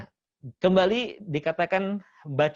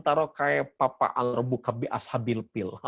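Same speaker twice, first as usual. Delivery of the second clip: mock Sundanese reading, f turned to p; 4 semitones lower than usual. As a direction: read it with a Sundanese accent, "kaipapa" with p for f. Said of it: "tapi orang Sunda mah gitu kan". (meniru logat Sunda, "kaipapa" dengan bunyi p)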